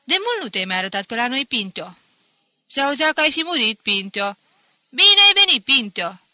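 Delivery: loud, high-pitched greeting; boisterous welcome. A narrator's reading. Villagers welcome Pinto back to the tavern, boisterous. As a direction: hail a long-absent friend loudly.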